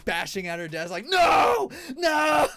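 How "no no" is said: very high shrieking no, much louder; mock tantrum scream. Retelling the claw miss, mock tantrum scream. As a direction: scream it like losing the prize. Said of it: "throwing a full temper tantrum".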